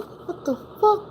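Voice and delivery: very high, slow baffled grandma voice. Retold or imitated. Grandma startled by husband's strange talk, high baffled squeak. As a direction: squeak it out in disbelief. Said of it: "she's going".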